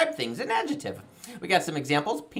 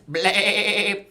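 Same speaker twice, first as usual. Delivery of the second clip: drawn-out wavering bleat; goat bleat. Pretend. Host retries farm song's goat, bleating. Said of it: "A goat's more of a".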